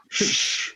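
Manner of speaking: long hushing "shh"; mimed hush, all breath; acted shushing. Acts out shushing a blaring alarm.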